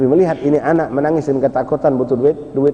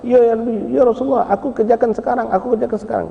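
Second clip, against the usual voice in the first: high, hurried eager-to-please voice; high voice of a boy rushing to obey; eager servant's reply; 11 semitones higher than usual. Acted. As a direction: answer at once, keen to obey. Anas answers the Prophet eagerly.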